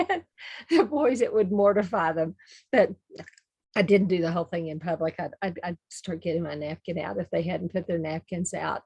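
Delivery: ordinary delivery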